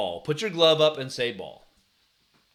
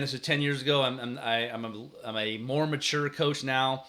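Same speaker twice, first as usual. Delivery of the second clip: ordinary talk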